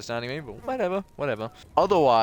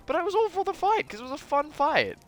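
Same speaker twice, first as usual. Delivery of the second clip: much higher, squeaky restatement; squeaky emphasis. Acted. Insisting to viewers the lost fight was fun, squeaky high voice.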